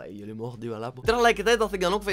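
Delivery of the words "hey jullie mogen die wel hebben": resigned murmur. Retold or imitated. Resigned inner thought, very soft.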